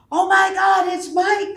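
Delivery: sudden high, loud cry of recognition; excited shout. Retold or imitated. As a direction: shout it out, thrilled to see him. Spirit recognizes his friend Mike.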